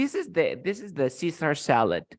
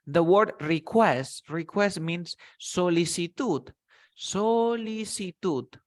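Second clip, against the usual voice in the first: slow teaching; 2 semitones higher, slower than usual. Shown as a demonstration. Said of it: "slow vocabulary model".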